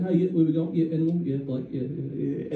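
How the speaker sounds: low, soft, dwindling welcome; driver deflated. Retold or imitated. Driver worn down by vomiting passengers, deflated. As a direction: let the greeting sag and fade. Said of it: "Just a little down here".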